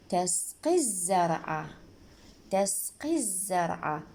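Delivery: تسقي الزرع slowly linked as "tasqi-zzzar'a"; silent sun lam. Shown as a demonstration. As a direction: skip the l and hold the doubled z in الزرع. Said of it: "حرف الل ما نلفظها". (إدغام اللام الشمسية)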